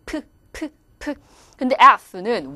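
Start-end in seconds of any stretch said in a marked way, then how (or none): 0.1-1.1 soft "프" popped with both lips; P sound. Shown as a demonstration. Teacher demonstrating bilabial to students, popped "프". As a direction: close both lips and puff each "프". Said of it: "입술 두 개를 붙이는".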